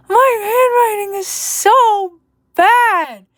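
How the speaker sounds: high, laughing through the words. Real, not acted. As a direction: crack up over your messy handwriting. Laughs at own handwriting.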